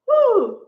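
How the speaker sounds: high, loud celebratory "woo"; cheering whoop. Real, not acted. Host cheers Ridge for finally making a decision, whooping. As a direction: let out a high-pitched whoop.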